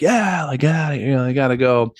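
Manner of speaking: high-pitched mock cheering; imitated crowd cheer. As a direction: imitate onlookers backing the kick-out. Retold crowd backing the kick-out.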